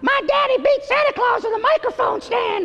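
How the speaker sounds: put-on very high yelped boy voice. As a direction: belt it in squeaky falsetto. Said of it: "my little [name] riff".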